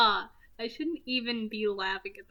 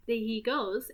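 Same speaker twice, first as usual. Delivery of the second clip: ordinary delivery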